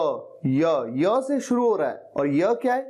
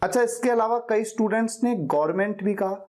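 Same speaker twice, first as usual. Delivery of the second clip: ordinary talk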